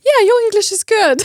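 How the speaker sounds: high, loud, fast laughing reply; mock cheerful friend; imitating friends. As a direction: mimic the friends brushing it off with a laugh.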